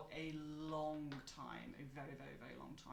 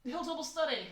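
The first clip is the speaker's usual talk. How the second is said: shouting across the library; calling out; 9 semitones higher, 10 dB louder than usual. Acted. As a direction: call out to a colleague. NPC calling to colleague.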